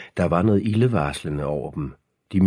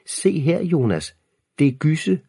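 high-pitched, louder little-sister squeal; lillesøsters hvin; 10 semitones higher, 4 dB louder than usual. A narrator's reading. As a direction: squeal it excitedly at the boys. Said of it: "vendte Freja sig om mod Jonas og hvinede". (hvinende, højt lillesøster-stemme)

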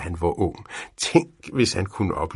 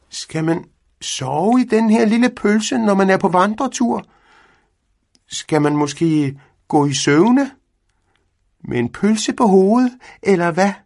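high, loud, cheeky cat voice; mocking cat; 12 semitones higher, 8 dB louder than usual. A narrator's reading. Cat mocking the sausage-shaped tent, high and loud. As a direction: tease the old man like a cheeky cat.

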